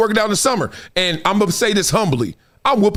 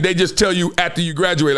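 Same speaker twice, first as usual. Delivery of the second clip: normal talking voice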